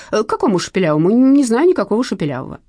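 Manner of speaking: ordinary delivery, nothing marked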